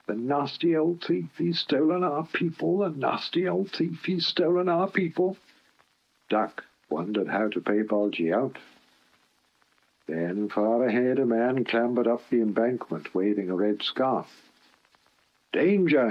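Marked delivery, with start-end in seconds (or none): 0.1-5.4 cross chatter. Acted. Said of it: "chattered crossly". 15.5-16.1 loud warning shout. Acted. Man on the embankment shouts a warning to Duck, loud. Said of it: "he shouted".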